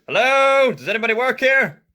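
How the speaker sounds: high loud call into emptiness. Acted. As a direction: call out to an empty room. Father calling into empty restaurant.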